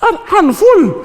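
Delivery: loud, high, drawn-out mock yell; exasperated mum. Mum answering her son's repeated question, exasperated. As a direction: belt it slowly as an exasperated mum. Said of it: "She said".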